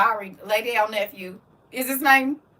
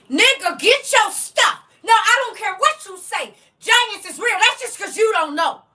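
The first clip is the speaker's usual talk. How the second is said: loud, higher, no-nonsense command; stern auntie order; 6 semitones higher, 4 dB louder than usual. Shown as a demonstration. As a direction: bark it like a fed-up auntie. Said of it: "See, you see the difference?".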